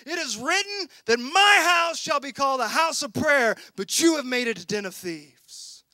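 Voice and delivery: loud, rebuking shout; angry Jesus. Retold or imitated. Jesus confronting the temple traders, shouting in anger. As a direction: thunder it at the money changers. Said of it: "excuse me as I say it as he said it".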